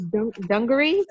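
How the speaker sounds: normal talking voice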